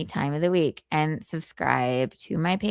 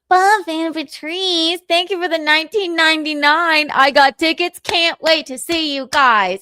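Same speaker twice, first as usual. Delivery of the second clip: put-on fan voice; 8 semitones higher, 7 dB louder than usual. Reading a fan message in voice.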